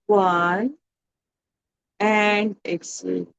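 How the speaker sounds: slow, measured breathing instructions with long pauses; guided meditation. Real, not acted. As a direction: guide a group slowly through each breath.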